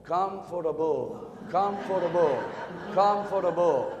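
each word stretched out slowly; drawn-out sleepy comfortable; slow drawn-out reading. Retold or imitated. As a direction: read it real slow, savoring it. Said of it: "She'll read this word real slow".

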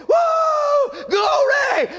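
high whooping shout of glory; ecstatic praise cry. Real, not acted. Preacher whooping glory to the congregation. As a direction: let the joy burst out.

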